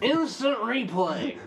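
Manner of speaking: high, slow announcer call. Retold or imitated. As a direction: call it like a replay booth. Sportscaster bit.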